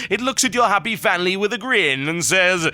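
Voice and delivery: slow, high, ominous storyteller voice; put-on sinister accent. Host reading Patrick's evil postcard, slow menacing accent. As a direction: draw the menace out, sinking at the end. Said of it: "might not say so in that accent".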